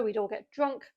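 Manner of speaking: ordinary talk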